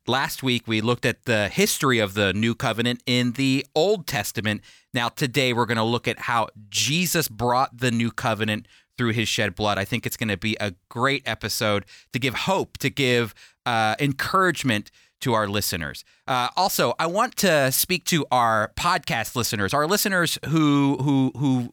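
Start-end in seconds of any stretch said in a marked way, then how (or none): none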